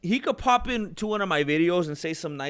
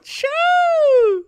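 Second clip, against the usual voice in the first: high squeal; 8 dB louder than usual. Real, not acted.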